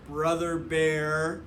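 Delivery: plain reading voice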